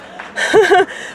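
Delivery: high, loud giggle; sudden high burst of laughter; amused laugh. Real, not acted. Amused at own remark.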